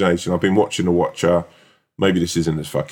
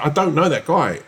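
high-pitched protest at usual volume; retold exasperated denial; 9 semitones higher than usual. Customer telling manager he doesn't know the diner, exasperated. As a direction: push back, higher, wrongly blamed.

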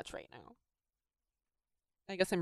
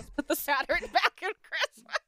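laughing through a playful slogan; giggling chant, pitched up; laughing, higher; 9 semitones higher than usual. Real, not acted.

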